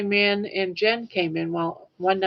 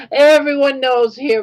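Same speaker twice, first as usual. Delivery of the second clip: real tears breaking through, higher and louder; crying; 5 semitones higher, 8 dB louder than usual. Real, not acted. To his hero Bill on the stream, crying real tears. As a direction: push the words out through crying. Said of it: "I'm crying again on my channel".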